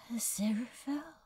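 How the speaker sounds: breathless, barely voiced whisper of a name; a fragile, hushed whisper, as if afraid to wake a dream. A narrator's reading. Crowley scarcely daring to call the angel's name, whispered. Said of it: "He hardly dared to whisper the name".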